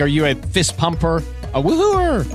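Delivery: ordinary talk, nothing marked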